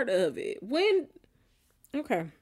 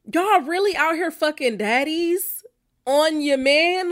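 normal talking voice

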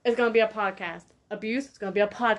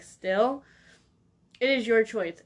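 normal talking voice

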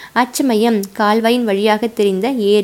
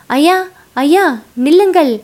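higher, louder frantic call; shouting after a rider, pleading him to halt; desperate shout; 6 semitones higher, 5 dB louder than usual. Acted. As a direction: yell at the prince to stop. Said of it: "கூச்சலிட்டான்".

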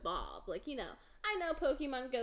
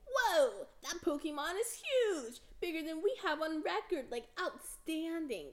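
impressed game voice; 6 semitones higher, 4 dB louder than usual. Retold or imitated. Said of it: "they're always like".

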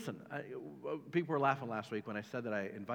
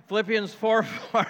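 slow, louder, higher mock bark of the verse; 9 semitones higher, 11 dB louder than usual. Pastor jokingly barks the verse at his staff, mock loud. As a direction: pretend to snap it at the staff. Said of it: "I'm kidding".

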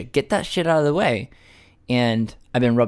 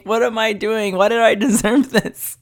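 high-pitched plaintive pet voice, amused; aggrieved cat; 10 semitones higher, 3 dB louder than usual. Retold or imitated. Man voicing his cat during flea treatment, aggrieved. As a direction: voice a wronged cat in raised pitch. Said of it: "and she's like".

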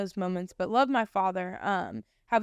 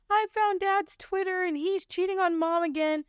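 high, tearful voice of a sister in shock; imitating crying sister; 10 semitones higher than usual. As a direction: retell her panicked, tearful words in a high pitch. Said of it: "she calls me crying".

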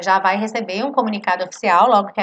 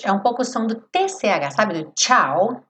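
normal talking voice

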